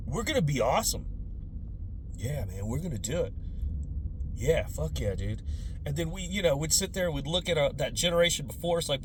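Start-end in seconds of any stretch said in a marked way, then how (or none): 0.1-5.4 put-on stoned teen. Teens smoking weed in the backyard, low and lazy. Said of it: "with our friends saying".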